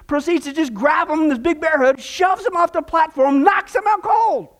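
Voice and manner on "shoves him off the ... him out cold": excited climax. Acted. Punchline climax of joke.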